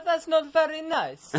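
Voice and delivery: high, slow mock Italian accent; Italian bloke impression. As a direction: protest in a put-on high Italian voice. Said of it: "Rodri does the impression best".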